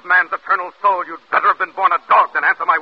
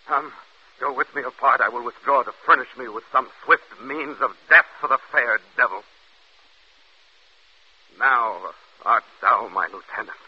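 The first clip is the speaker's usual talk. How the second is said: ordinary delivery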